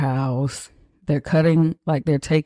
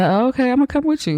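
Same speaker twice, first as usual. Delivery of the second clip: much higher, fast eager voice; eager girlfriend; 10 semitones higher, much faster than usual. Retold or imitated. Girlfriend inviting herself to the party, high and eager. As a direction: chirp it quickly, inviting herself. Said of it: "And she's like".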